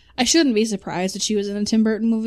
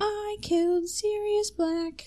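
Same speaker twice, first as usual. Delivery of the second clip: high, slow, softer gloating witch voice; much higher, slow mock Bellatrix taunt; Bellatrix impression; 13 semitones higher, 5 dB quieter, much slower than usual. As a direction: savour the confession like a mad witch. Voicing Bellatrix from Harry Potter.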